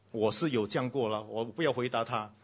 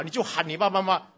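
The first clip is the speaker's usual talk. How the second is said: ordinary delivery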